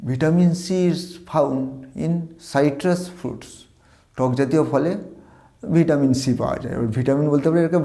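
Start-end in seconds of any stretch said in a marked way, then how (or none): 0.0-3.5 deliberate teacher's model reading; slow model sentence. Shown as a demonstration. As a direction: read very slowly, word by word. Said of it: "এর বাক্যটা আছে".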